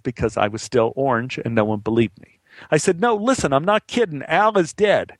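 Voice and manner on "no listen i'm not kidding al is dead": much higher, urgent pleading; insistent. Retold or imitated. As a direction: make them believe you, pitch up. Nobody believes him.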